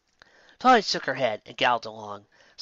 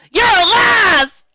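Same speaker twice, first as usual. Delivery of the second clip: loud, high, slow joyful cry; 13 dB louder, much slower than usual. A narrator's reading. Applejack to Dash, loud slow joyful cry. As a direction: burst out in joy. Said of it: "she cried out".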